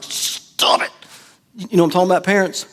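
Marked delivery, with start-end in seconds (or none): 0.0-0.9 whispered stop it; parent hushing kids. Retold or imitated. Parent whispering at squabbling kids in the car. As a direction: hiss it at the kids in back. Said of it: "and you're like".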